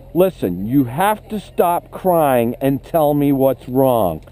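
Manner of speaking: put-on slow, deliberate and firm to a crying child; firm direct instruction. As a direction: lay down the rule calmly, word by word. Instructor firmly tells crying child.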